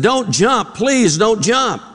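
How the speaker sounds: high-pitched, hammed-up plea; urgent pleading. Counselor to man on the ledge, hammed-up pleading.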